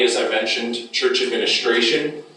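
ordinary talk

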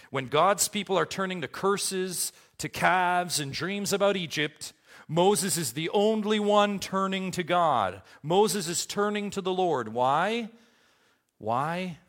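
high, loud pulpit delivery; full-force preaching. Acted. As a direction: drive each point out to the congregation.

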